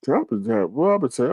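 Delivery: ordinary talk, nothing marked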